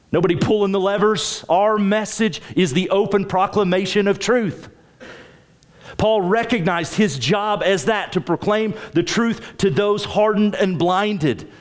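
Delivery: high, emphatic sermon delivery; pitched high, preaching with conviction; impassioned preaching. Real, not acted. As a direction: drive each phrase home to the congregation. Preaches with rising fervour.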